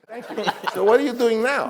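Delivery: put-on high, proud old mogul voice; Sam Goldwyn praising. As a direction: gush over the young director in a high fussy voice. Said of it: "he talked like I'm gonna talk".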